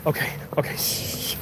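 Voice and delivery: hushed, panicky whisper. Pretend. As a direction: whisper so nobody hears. Hiding from the police.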